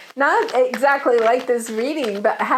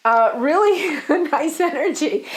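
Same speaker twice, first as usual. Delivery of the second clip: laughing; 4 semitones higher than usual. Real, not acted. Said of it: "I'm laughing because I don't know".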